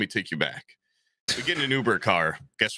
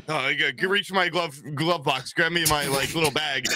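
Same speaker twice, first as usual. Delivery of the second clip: mock-nervous stumbling request in a raised pitch; retold nervousness; 6 semitones higher than usual. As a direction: imitate a nervous driver, higher-pitched. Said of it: "driver was so nervous".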